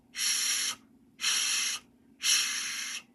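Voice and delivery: soft unvoiced steam hiss. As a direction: hiss like a steam engine letting off steam. Train steam sounds in story.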